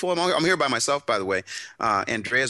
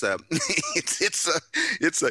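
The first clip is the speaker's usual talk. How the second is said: ordinary delivery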